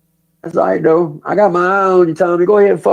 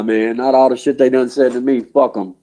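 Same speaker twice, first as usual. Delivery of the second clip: normal talking voice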